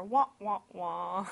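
mock sad trombone wah-wah. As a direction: sing the foreshadowing sting wah wah wah. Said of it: "in my head, I hear".

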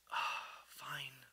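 soft, breath-only sigh of assent; grudging resignation. Retold or imitated. Israelites agreeing to keep walking, resigned. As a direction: give in with a mock weary exhale.

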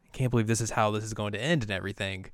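ordinary talk

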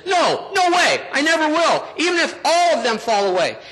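high, emphatic mock refusal; hammed-up indignant denial; Peter. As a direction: protest hotly, starting high and easing down. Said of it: "But instead he says".

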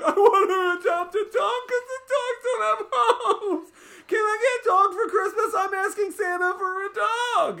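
put-on very high, loud, whiny sobbing child; crying kid pleading for a dog; mock crying kids. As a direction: wail it like a heartbroken kid begging. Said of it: "they're all fucking crying".

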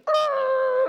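sudden high yell at a crash; startled yell. Retold or imitated. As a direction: yell in shock as the deer hits. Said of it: "I'm just like".

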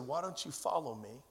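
low, soft retold voice of God; lightly voiced God, quietly inviting. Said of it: "heard the audible voice of God".

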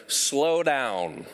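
a running app telling the pastor to slow down. Retold or imitated. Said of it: "here's the message I hear".

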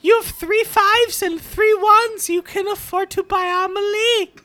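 high, fast squeaky disc voice; talking DVD voice. Acted. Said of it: "I'm Amelie".

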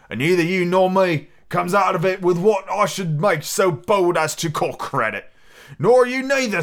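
hearty, swinging salty sailor voice; old sea dog. Acted. Long John chummily rallying young Hawkins, as a hearty sea dog. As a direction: roll it out like an old seafarer.

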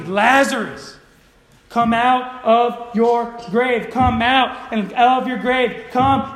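slow, loud summons; loud calling. Acted. Preacher as Jesus summoning Lazarus from the grave, loud and drawn out. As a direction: call out loudly, stretching each word. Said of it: "Jesus said loudly".

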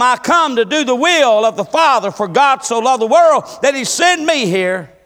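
loud, high, pounding sermon delivery; fiery Southern preacher building to a peak; preaching at full force. Acted. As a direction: preach it hard and rising, pushing every phrase.

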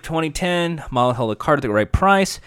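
ordinary talk, nothing marked

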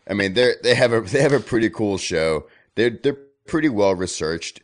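fired-up praise; excited. Real, not acted. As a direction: talk it up loudly. Excited enthusing about podcast.

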